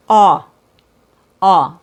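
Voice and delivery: Aw with the jaw dropped and lips rounded, said loud; loud clear vowel demo; aw sound demo. Shown as a demonstration. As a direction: open round Aw, held out. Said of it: "only the bottom lip moves and it's rounded".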